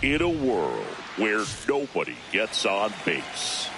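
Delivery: low, dramatic trailer narrator; movie trailer voice. Pretend. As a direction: intone it like an epic film trailer. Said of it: "cue the movie trailer voice".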